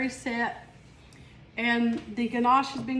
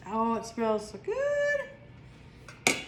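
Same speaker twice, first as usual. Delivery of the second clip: savoring a smell; 3 dB quieter, much slower than usual. Real, not acted.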